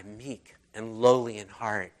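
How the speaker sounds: usual speaking voice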